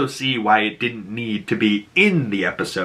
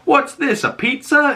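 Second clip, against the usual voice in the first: high, puzzled cop voice; mock-curious officer; dopey cop; 14 semitones higher than usual. Police officer at the scene, high and puzzled. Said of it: "the police officers goes".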